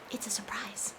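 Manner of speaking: secret whisper, barely breathed. Acted. Host hiding a surprise from the kids, whispered.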